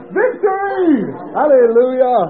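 high, loud rejoicing; triumphant shout. Retold or imitated. Healed man answering the preacher, high retold shout of triumph. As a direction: cry out in victory.

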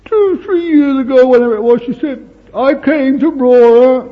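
put-on young woman thanking the preacher, emotional; young woman recalling gratefully. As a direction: gush as the grateful young lady.

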